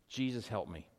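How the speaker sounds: soft, low plea; simple prayer. Shown as a demonstration. Preacher models the simplest prayer to Jesus, soft plea.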